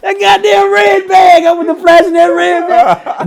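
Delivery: slow, high, loud and exultant; triumphant shout. Real, not acted. Telling his friend the climax, with a triumphant shout.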